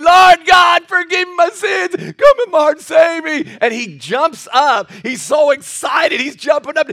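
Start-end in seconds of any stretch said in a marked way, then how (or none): 0.0-3.6 much higher, loud imitated prayer shout; crying out. Big guy crying out to God, loud high shout. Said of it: "He's crying".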